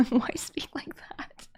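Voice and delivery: laughing whisper, quoting herself; breathless whispered confusion; whispered quote. Retold or imitated. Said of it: "I was like".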